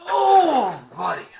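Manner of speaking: sudden high yelp of surprise; surprised. Real, not acted. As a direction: caught off guard, squeal it. Surprised by Andy appearing.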